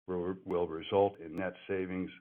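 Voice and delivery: ordinary delivery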